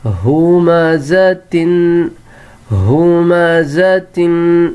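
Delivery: ہمزتن drawn out, hu-ma-za-tin; slow model reading. Shown as a demonstration. Teacher to pupils, drawn-out model word. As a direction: sound out ہمزتن slowly. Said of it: "یہ ہا کے اوپر دمہ ہے".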